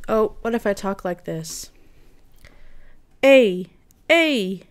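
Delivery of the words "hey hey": loud mic test call; loud mic check. Shown as a demonstration. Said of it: "let me hear it".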